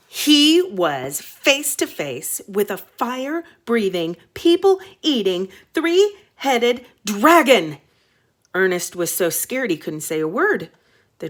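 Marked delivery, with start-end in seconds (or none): 0.1-7.8 slow, dramatic reading; slow down for the big reveal. A narrator's reading. Reader revealing the dragon, slow dramatic reading.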